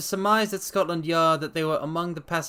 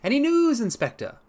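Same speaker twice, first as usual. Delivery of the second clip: much higher, eager question; eager Holmes; 13 semitones higher, 5 dB louder than usual. Acted. Holmes greeting the inspector, eager and high. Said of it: "Holmes enters".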